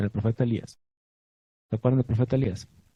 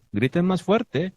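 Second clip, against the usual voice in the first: higher, drawn-out mock call; retelling the prophet's taunt, pitched up and slow; retold taunting call; 7 semitones higher, slower than usual. Prophet Elías goading worshippers to shout, retold taunting call. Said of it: "¿Qué dijo Elías?".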